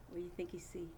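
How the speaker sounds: whisper. Acted. Teacher teases class in whisper.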